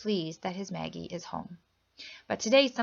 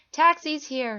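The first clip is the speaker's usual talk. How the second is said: higher and louder call; 7 semitones higher, 7 dB louder, slower than usual. A narrator's reading. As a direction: call it out to the house. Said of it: "Maggie calls out, but her voice is choked".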